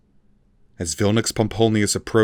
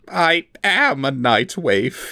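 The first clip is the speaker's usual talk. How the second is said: slow, higher defeated confession; confessing; 8 semitones higher, 3 dB louder, slower than usual. A narrator's reading. The broken elf admits the truth, slow and higher. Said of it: "he confessed".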